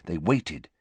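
ordinary delivery

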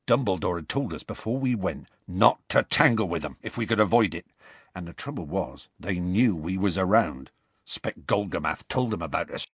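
rustic storyteller, building in energy; Hagrid recounting. Acted. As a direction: tell of the danger in dialect. Hagrid continues.

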